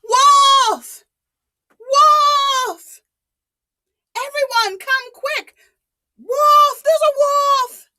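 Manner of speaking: high, loud cries of wolf; yelling for help. Acted. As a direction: shout to the whole village from a tree. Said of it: "and yelled".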